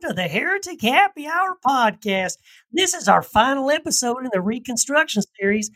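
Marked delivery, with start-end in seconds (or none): 0.0-2.4 hammed-up Southern cowboy greeting, high; cowboy Southern accent. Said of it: "good to hear your regular voice back".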